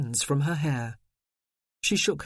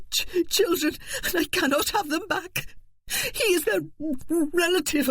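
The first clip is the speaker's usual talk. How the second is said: sobbing panic; 12 semitones higher than usual. Acted. Wife sobbing about taken children.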